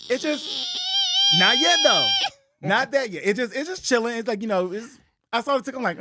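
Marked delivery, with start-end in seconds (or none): 0.1-2.1 high whistling squeal of a kettle; kettle whistle. Retold or imitated. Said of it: "like a tea kettle".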